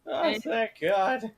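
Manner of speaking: high, slow relieved laugh-sigh. Real, not acted. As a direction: stretch the relief out. Relief after wolf bites other.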